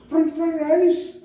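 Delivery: high, slow, loud put-on cook voice; mock cook voice. Cook covering up when caught, high and slow. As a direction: stretch out the question. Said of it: "he was like".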